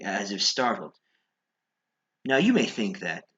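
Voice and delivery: usual speaking voice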